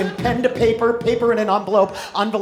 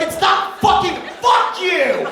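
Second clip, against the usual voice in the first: louder, higher fed-up yell; annoyed viewer yelling at the screen; exasperated outburst; 8 semitones higher, 7 dB louder than usual. Acted. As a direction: snap at the TV. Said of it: "And you're like".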